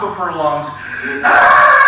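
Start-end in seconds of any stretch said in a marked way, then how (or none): 1.2-1.8 a sudden, loud, low raw scream; imitated scream. Chiropractor mimics the screaming patient, loud raw scream. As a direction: belt out a patient's wordless scream. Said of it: "this woman is screaming at the top".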